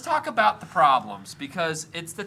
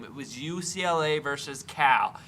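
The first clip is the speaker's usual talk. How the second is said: ordinary talk, nothing marked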